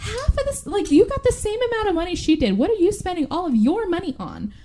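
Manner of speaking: high-pitched exasperated questioning; playful indignant scolding; incredulous rebuke. Acted.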